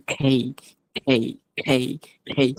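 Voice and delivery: airy kh at the start of "খেই"; aspirated K. Shown as a demonstration. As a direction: drill "খেই" with a puff of breath. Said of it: "কটা খর মতো করে হবে".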